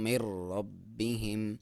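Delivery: من ربہم with noon merged into ra, no nasal; slow recitation. Shown as a demonstration. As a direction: chant it slowly, merging the noon. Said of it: "یہاں پہ را کے ساتھ ادغام ہے".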